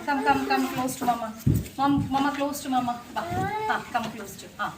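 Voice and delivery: very slow, drawn-out come close to mama; slow sing-song call to the little one; slow coaxing. Real, not acted. Calling toddler to her slowly.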